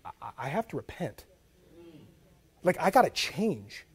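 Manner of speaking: whisper-close and hurried, almost to yourself; hushed urgent confession. Retold or imitated. Preacher imitating a convicted Bible reader, hushed.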